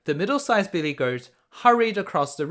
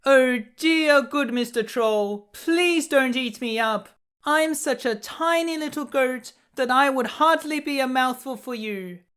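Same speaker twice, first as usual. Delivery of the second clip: much higher, tiny frightened goat voice; small pleading goat, high and timid; little goat, frightened; 9 semitones higher than usual. A narrator's reading. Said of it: "he cried".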